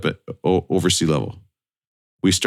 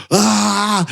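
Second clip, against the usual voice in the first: mock excited yelp; 12 semitones higher, 3 dB louder than usual. Imitates boys running up.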